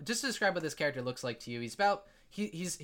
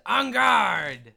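high, loud dragon's challenge; battle challenge; 6 semitones higher, 10 dB louder than usual. Acted. Kid dragon breathing fire at the players, shouted challenge. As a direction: shout it out as a theatrical call to arms. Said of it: "and he says".

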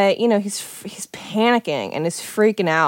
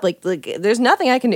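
ordinary talk, nothing marked

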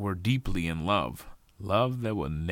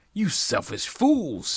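high, drawn-out shouted insult; angry shout; 9 semitones higher, 5 dB louder, slower than usual. A narrator's reading. The Joneses insult the Smiths, shouting. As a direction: shout it slowly at the rival family. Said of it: "shouted the Jones".

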